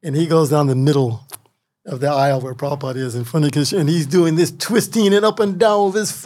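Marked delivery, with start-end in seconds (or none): none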